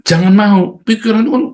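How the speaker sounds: high, loud warning; forceful urging. Real, not acted. Preacher warning listeners, high and loud. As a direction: cut them off, firmly.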